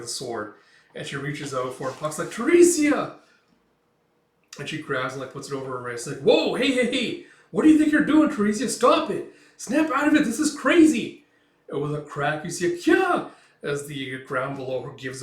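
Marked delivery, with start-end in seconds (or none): 2.3-3.2 high, loud call of a name; alarmed cry. Retold or imitated. Puck cries out to stop her. 6.2-11.3 high, loud, panicked protest; acted alarm at full pitch; frantic pleading shout. Puck frantically stops a suicide. 12.8-13.3 short, high, loud squeal; startled yelp as the ground drops away; imitated manga scream; acted falling shriek. Teresia shrieks as ground gives way.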